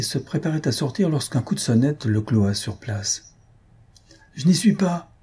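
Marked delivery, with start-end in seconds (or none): 4.3-5.1 calling out. A narrator's reading. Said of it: "cria-t-il à Marfa".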